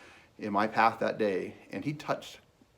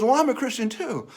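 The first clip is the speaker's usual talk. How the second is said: high, loud imitated exclamation; excited discovery; 12 semitones higher, 6 dB louder than usual. Young man finding stranger shares his faith, excited discovery. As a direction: voice his eager surprise at common ground.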